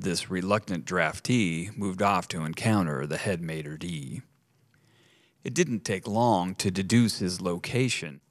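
plain reading voice

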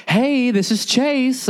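a cheesy phone rep savoring his name; smarmy bank guy. Retold or imitated. Bank rep named Chase greets the caller, high slow and smug. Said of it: "and I hear".

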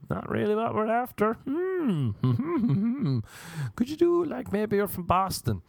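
high-pitched mock casting director; picky casting director. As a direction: reject politely in a high voice. Casting person turning him down, asking again.

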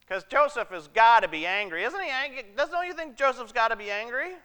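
ordinary delivery

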